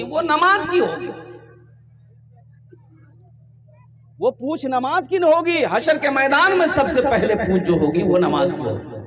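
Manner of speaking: rhythmic sermon cadence that swells and drops; impassioned preaching. Real, not acted. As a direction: pitch climbing to a high warning, then falling. Preaches with rising force.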